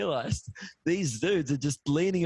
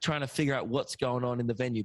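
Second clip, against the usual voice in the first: ordinary talk, nothing marked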